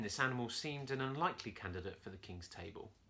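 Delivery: usual speaking voice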